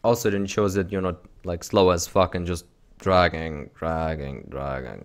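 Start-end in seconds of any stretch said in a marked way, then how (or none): 3.0-5.0 dragging. Shown as a demonstration. Acting out a sluggish, dragging feel.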